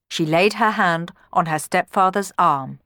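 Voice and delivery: ordinary delivery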